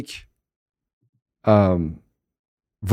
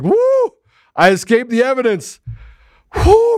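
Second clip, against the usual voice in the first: mock gleeful escape; 8 semitones higher than usual. Voicing the judge dodging evidence.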